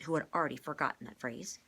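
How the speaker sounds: ordinary delivery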